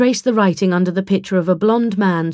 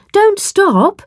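much higher, louder, rising call; loud encouragement; 13 semitones higher, 5 dB louder than usual. Acted. Charlie urges the villagers to keep singing, loud encouraging call. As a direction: cheer them on to keep singing. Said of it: "she said in a loud encouragement".

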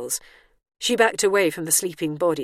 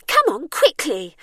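high, loud, drawn-out impatience; irritable urging; 10 semitones higher, 6 dB louder than usual. A narrator's reading. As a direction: urge them on crossly in a raised voice. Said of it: "said the woman irritably".

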